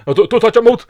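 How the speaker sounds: high, loud mock warning through laughter; mock alarm. Joking to his co-host about the mould, high loud mock alarm. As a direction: shriek it like the mould is precious.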